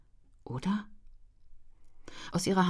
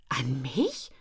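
a baffled little question; taken aback, unsure; perplexed. A narrator's reading. Ellen asked to write a romance, perplexed. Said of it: "fragte sie perplex".

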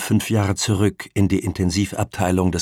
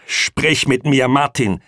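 loud, raised, slow command; hard; 6 semitones higher, 6 dB louder, slower than usual. A narrator's reading. Pamela demands that Martin talk to her, hard. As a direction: order it hard, drawing out the words. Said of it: "sagte sie hart".

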